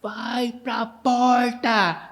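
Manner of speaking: slow, drawn-out shouted order; shouted in the ear. Retold or imitated. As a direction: yell it into someone's ear over engine roar. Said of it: "falou bem alto no meu ouvido".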